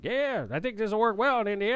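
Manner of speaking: mock Southern accent, pitched high; a light put-on Southern drawl. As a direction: play the Indiana rep putting on a Southern twang. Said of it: "Is that offensive when I do that?".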